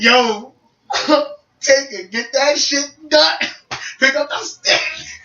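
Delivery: high, laughing summons; rally a rival, drawing out the end; hyped challenge. Retold or imitated. Calling brother out to play.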